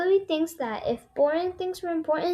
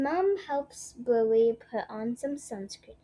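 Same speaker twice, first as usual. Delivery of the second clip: usual speaking voice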